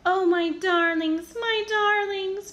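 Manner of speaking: high, slow tender cry of relief; mother reunited, gushing. A narrator's reading. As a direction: pour out love to the pups. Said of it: "Perdita gushed".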